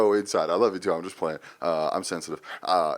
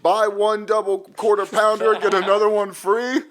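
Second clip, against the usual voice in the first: higher, louder goofy customer; mock customer voice; 10 semitones higher, 5 dB louder than usual. Ordering at McDonald's with a coupon, goofy higher voice. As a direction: ham up the coupon-wielding regular. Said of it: "imagine I'm going in there every time going".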